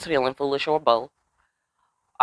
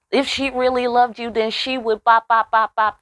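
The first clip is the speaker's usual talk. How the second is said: higher, sing-song teasing voice; higher, lightly sung mock temptress; 8 semitones higher than usual. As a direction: tease it like a seductress. Mocking the woman's line again.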